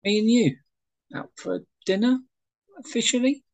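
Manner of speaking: high-pitched, halting questions; eager, uncertain invitation; tentative hopeful asking. Acted. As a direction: blurt it, then float each question up high. Wayne nervously asks her out.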